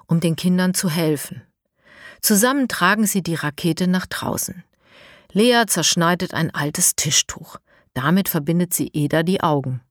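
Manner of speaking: ordinary delivery, nothing marked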